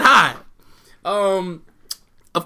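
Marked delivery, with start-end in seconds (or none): 0.0-0.3 loud mock greeting; a loud, cheery hi. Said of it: "Our bill said".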